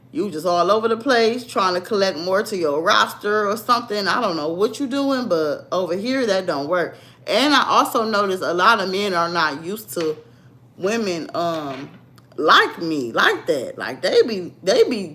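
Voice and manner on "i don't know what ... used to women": ordinary talk, nothing marked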